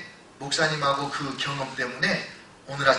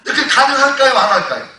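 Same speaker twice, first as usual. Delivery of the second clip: laughing challenge; 10 semitones higher, 13 dB louder than usual. Real, not acted. Preacher challenges congregation, laughing.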